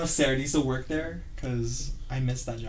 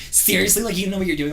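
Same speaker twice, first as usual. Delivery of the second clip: higher, scornful retold question; mock mean coworker; 7 semitones higher, 4 dB louder, faster than usual. Mean coworker scolding the newbie, mock scornful. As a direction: imitate a disbelieving, snippy coworker. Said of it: "She was always so mean to me".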